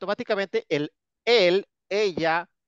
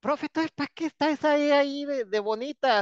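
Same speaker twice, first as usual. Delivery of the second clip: mock student question; 10 semitones higher than usual. Teacher voices a student's question.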